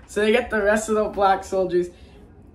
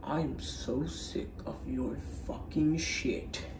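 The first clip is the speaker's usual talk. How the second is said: slow, much softer disgusted line; quiet slow exasperated retort; low fed-up voice; 3 semitones lower, 12 dB quieter, slower than usual. Retold or imitated. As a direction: drag it out, worn out. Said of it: "Hussein's like".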